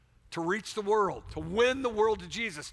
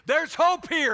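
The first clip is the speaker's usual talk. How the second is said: hope shouted high and loud; preached shout; 10 semitones higher, 10 dB louder, slower than usual. Real, not acted. Pastor to congregation, shouting there's hope here. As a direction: ring out hope to the crowd. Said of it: "Everybody say that".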